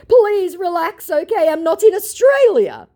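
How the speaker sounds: high, loud, pleading mock scammer. Mocking the scammer's plea to her, high and loud. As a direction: ham up the scammer's fake soothing.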